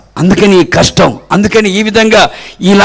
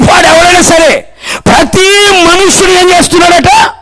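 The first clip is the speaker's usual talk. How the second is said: raised, ringing sermon delivery; full-force preaching; 10 semitones higher, 4 dB louder than usual. Real, not acted. Preacher telling the church it applies to everyone, full-force preaching. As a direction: preach it out high to the crowd.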